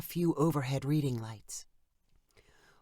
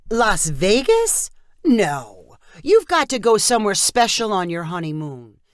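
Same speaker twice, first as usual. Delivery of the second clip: disapproving aunt; 4 semitones higher, 7 dB louder than usual. Acted. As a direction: scoff at the idea like a bossy aunt. Aunt objects.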